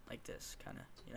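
hushed demonstration of speaking softly; soft, low-volume example. Shown as a demonstration. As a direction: drop the volume right down. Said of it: "just a tad bit quieter".

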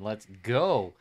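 ordinary talk, nothing marked

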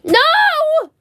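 high shriek. Real, not acted. Refusing, shocked.

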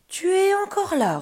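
high, very loud ogre roar. Acted. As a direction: bellow in outraged disbelief. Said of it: "rugit l'ogre".

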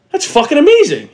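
high amazed exclamation. Retold or imitated. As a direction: gush over how easy it was. Speaker's amazed reaction.

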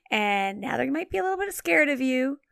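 normal talking voice

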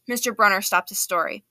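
ordinary delivery

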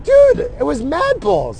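high, loud, drawn-out protest; mock exclamation. Player protesting to the kid who gave it to him, exclaiming.

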